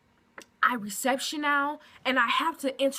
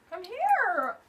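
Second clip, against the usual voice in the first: higher, sing-song call to a pet; coaxing dog voice; 7 semitones higher than usual. Real, not acted. Calling Kobe over to meet viewers, coaxing. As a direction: coax the dog over sweetly.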